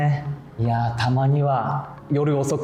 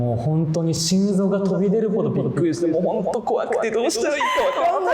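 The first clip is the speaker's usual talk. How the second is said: mock panic; 6 semitones higher than usual. Comic panic over geckos and roaches.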